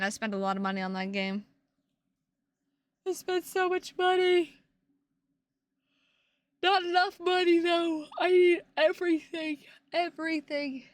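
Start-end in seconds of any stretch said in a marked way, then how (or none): 3.1-10.8 high, loud whiny complaint; mock whiny gamer. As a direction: whine like a spoiled kid who wants it all.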